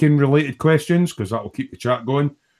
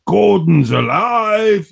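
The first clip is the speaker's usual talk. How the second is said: high, booming catchphrase cry; hammed-up exclamation; 7 semitones higher, 6 dB louder, much slower than usual. Host announces his likely Saturday co-host, hammed-up exclamation. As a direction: belt it out as a mock-heroic announcement.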